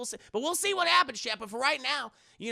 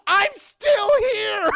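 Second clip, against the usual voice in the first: slow, high hammed-up screech; indignant scream; 12 semitones higher, 12 dB louder, slower than usual. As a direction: scream it out as the ignored villainess. Ignored villainess screams again.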